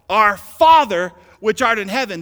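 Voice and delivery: loud, high preacher's shout; emphatic shout. Shown as a demonstration. Preacher shows how the Greek would read, shouted emphatically. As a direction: belt out the prayer with heavy emphasis. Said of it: "This is how it would read".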